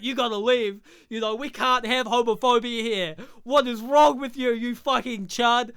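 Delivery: loud, high-pitched hammed-up telling-off; mock angry confrontation. Acts out Joel confronting a homophobe.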